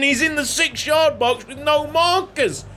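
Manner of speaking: ordinary delivery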